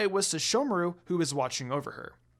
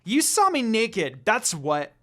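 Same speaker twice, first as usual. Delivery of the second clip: louder, slow embarrassed yell; shouting; 6 semitones higher, 7 dB louder, slower than usual. Acted. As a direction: shout it at him, flustered. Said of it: "Kagome shouted at him".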